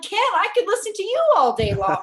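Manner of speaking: playful high-pitched protest to a friend; animated retold exclamation. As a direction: gush in high-pitched disbelief, quoting yourself. Retelling her gushing reply to Ken.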